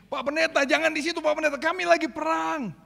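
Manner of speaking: high, urgent calling out; frantic warrior call. Retold or imitated. Warrior calling the pastor out of the fight. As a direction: shout him off the battlefield.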